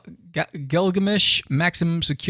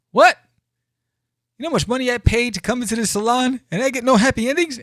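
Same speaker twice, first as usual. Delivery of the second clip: mock outrage; 10 semitones higher, 4 dB louder, faster than usual.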